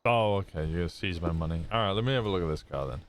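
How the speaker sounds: ordinary delivery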